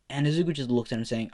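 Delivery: ordinary delivery, nothing marked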